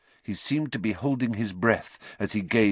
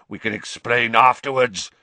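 snarl; 3 semitones higher, 5 dB louder than usual. A narrator's reading.

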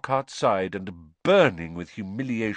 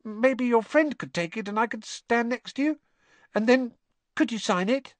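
put-on very high, pleading boy's voice; imploring schoolboy. A narrator's reading. Colin begging Harry for a signed picture, imploringly. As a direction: ask pleadingly, pitched way up. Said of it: "He looked imploringly at Harry".

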